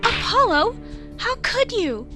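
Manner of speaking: high, loud, hurt disbelief; shocked reproach. Acted. Girl appalled that her friend doubts Athena, shocked reproach. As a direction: cry out as if betrayed.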